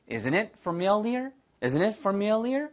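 slow, rising "Isn't it familiar?"; slow model line. Shown as a demonstration. Teacher models the line slowly for students to echo. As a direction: stretch it out for repeating. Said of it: "your turn".

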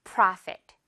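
Profit with a wide open ah; model Profit. Shown as a demonstration. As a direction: say Profit for the class. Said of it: "프라였습니다".

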